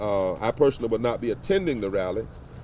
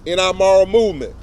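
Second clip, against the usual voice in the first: rising oratory, paced and emphatic, a touch louder; formal speech; 3 semitones higher, 3 dB louder than usual. Acted. Reverend reading an open letter to the press, in formal oratory. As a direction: preach the letter like a pulpit address.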